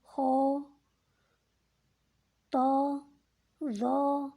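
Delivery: thick, rounded letters; hollow the mouth for a full sound; heavy letters. Shown as a demonstration. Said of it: "حرف کے اندر تفخیم آ جائے گی". (تفخیم کے ساتھ موٹا)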